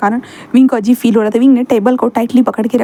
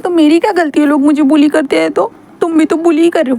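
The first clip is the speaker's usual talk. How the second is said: higher whiny voice, hurt, building to anger; sulky young man; 4 semitones higher than usual. Acted. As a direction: pout and complain like a wounded boy. Wing voiced.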